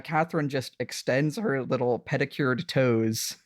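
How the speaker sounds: normal talking voice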